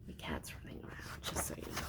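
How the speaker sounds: soft whispered side remark; whisper aside. Real, not acted. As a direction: whisper it so the story isn't broken. Hushed aside to the listening child.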